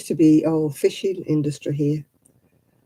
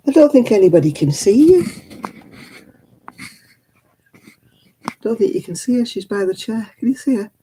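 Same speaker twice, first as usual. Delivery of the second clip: high, fond cooing, sometimes louder; 7 semitones higher, 3 dB louder than usual. Real, not acted. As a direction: gush over the dog lovingly. Cooing at the pet Rosie.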